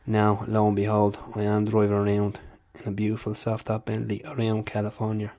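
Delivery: put-on Dublin brogue, fighter impression; Conor McGregor. Impressionist as a fighter boasting about his Bentley, Dublin accent. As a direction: tell your success story in a Dublin accent. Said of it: "you know, Conor McGregor".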